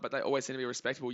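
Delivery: ordinary delivery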